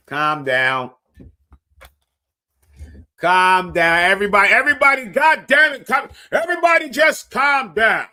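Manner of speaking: mock-yell at the chat to calm down; hammed-up angry shout. Escalating mock rant at chat.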